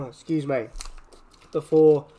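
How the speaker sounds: sharp choking coughs; coughing up water. Real, not acted. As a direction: cough as water goes down wrong. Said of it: "that water went down the wrong way".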